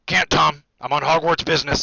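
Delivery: loud, hearty big-man voice; booming Hagrid. A narrator's reading. Hagrid turning down a drink, booming. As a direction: boom it out across the bar.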